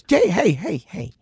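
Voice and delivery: high, whispery warning; scolding mom. Retold or imitated. Mom pulls back candy bowl, high whispery scolding. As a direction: imitate a startled mom's breathy telling-off.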